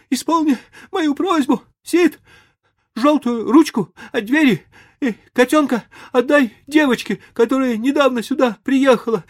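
very high, loud mock-tragic farewell; melodramatic deathbed. A narrator's reading. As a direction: play the dying hero. Tom's mock deathbed wishes.

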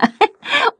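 high-pitched giggle; clownish laugh. A narrator's reading. Butterflies in stormy excitement, high giggle. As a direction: let out a high squeaky giggle.

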